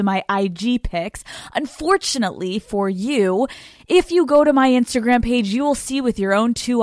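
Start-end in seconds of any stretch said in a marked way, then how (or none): none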